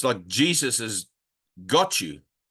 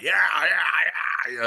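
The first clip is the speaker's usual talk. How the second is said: mock brash New York guy, higher pitched; brash New Yorker; 5 semitones higher, much slower than usual. Imitating the teacher from New York, brash manner. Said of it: "he's got this manner about him".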